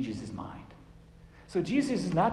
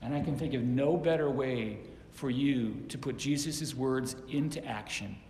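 ordinary talk

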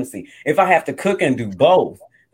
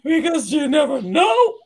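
mock outraged wife; wife's rant; 2 semitones higher than usual. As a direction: push back hard, escalating. Wife ranting about cooking.

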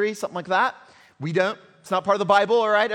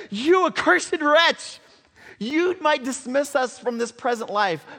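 defiant outcry; 8 semitones higher, 6 dB louder than usual. Acted. A son facing the king, crying out in defiance. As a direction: hurl it at the king like a curse.

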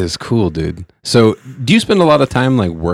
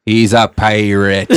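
slow, failed Jamaican sounding Irish; Jamaican turned Irish; slower than usual. Acted. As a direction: stretch it with an Irish slip. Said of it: "All of a sudden it's Irish".

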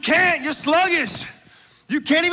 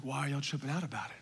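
low, quick and very soft; casual imitation, fast and quiet; offhand mock question; 11 semitones lower, 15 dB quieter, faster than usual. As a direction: toss it off under your breath. David's nonchalant question, voiced aside.

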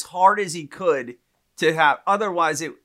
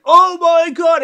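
high, loud crowd-reaction mimicry; imitated shocked onlookers; 12 semitones higher, 9 dB louder, slower than usual. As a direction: shout it like the stunned guys watching. Imitating shocked guys watching a punch.

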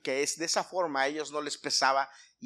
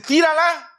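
high, loud acted order; shouted command; 13 semitones higher, 12 dB louder than usual. They order the skilled warrior to shoot, shouting. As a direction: fire it out as an urgent order.

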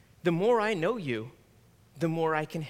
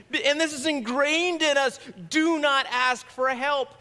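raised, emphatic preaching; 8 semitones higher, 3 dB louder than usual. Real, not acted. Pastor warns the congregation, raised and emphatic. Preacher stresses ingrained cultural rule.

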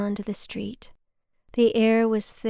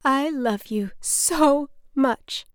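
slow, higher, tearful; a hint of the mother's crying voice, drawn out; mother crying at the bus station; 5 semitones higher, 3 dB louder, much slower than usual. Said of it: "She was crying by this point".

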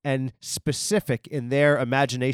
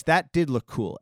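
usual speaking voice

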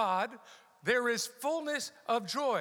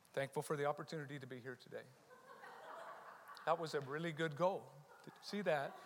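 very soft, low and fast, mocking polite murmur; whisper-soft mock of a timid crowd; murmured, lower mock crowd chatter with a laugh; mock quiet fan; 7 semitones lower, 11 dB quieter, faster than usual.

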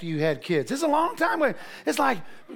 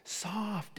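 slow, soft loving coo; father cooing to his newborn, slow and soft; tender murmur; 12 dB quieter, much slower than usual. Retold or imitated. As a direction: coo to your baby daughter. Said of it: "I said".

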